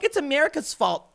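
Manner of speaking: high, whiny mock of protesters; mocking whiny quote. Host mocking dumb protesters, shrill whiny voice. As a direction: pitch it way up, ridiculing them. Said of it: "these people are so dumb that they're like".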